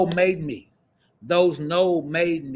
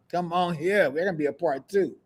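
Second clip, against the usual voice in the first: normal talking voice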